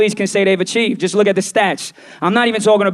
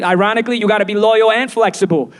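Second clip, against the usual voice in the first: usual speaking voice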